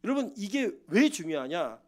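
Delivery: usual speaking voice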